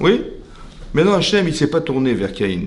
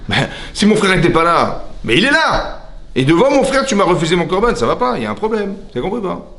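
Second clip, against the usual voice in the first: high, heated protest of a slighted brother; acted outrage leaping up in pitch; Caïn, indignant; 7 semitones higher than usual. Caïn objects to rejection in front of his brother, indignant. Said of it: "Il s'est mis en colère".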